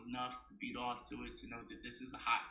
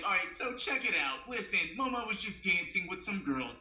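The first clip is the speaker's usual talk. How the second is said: higher, louder put-on well-spoken voice; 5 semitones higher, 4 dB louder than usual.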